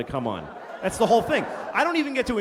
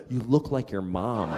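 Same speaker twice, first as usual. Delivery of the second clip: misheard line; 8 semitones lower, slower than usual. Retold or imitated. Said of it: "She thought I said".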